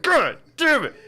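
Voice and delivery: high, loud annoyed god damn it; hammed-up irritation at being shot; mock curse. Victim waking up shot, cursing loudly in mock annoyance.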